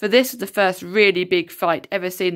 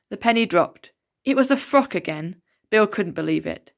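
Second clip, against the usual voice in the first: ordinary delivery, nothing marked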